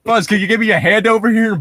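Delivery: a hint of Woody asking a favor; quoting Woody. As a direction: call out to Buzz for help.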